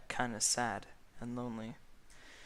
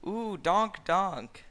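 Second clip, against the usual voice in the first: mock moan; 8 semitones higher, 9 dB louder, much slower than usual. A narrator's reading. Mock moaning.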